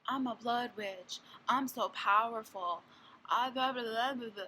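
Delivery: smug mocking Morgan. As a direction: puff up like a cocky teen witch. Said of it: "And Morgan was like".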